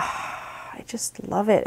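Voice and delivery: unvoiced, slow, swooning; breathless whisper. Real, not acted. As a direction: sigh it out in love. Swooning over knitting yarn.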